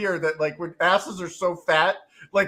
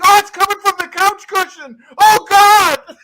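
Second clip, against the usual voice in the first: much higher and louder, crying out; disgusted shout; 12 semitones higher, 13 dB louder than usual. Retold or imitated. Roommate finds the couch cushion source, shouting in disgust. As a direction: wail at the cushion's smell. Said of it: "He's like".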